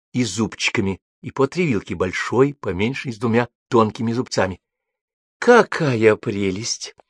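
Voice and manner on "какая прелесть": slow, drawn-out admiring exclaim; genuinely delighted. A narrator's reading. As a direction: savour the words in delight. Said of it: "неподдельно восхищённо сказала Рима".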